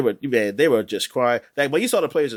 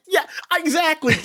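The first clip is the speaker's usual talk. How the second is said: laughing; 14 semitones higher than usual. Real, not acted. Laughing at cohost's fantasy loss.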